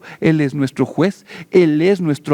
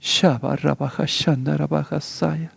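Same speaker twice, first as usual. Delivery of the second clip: softer murmured glossolalia; speaking in tongues; 5 dB quieter than usual. Real, not acted. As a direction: hablar en lenguas en voz baja.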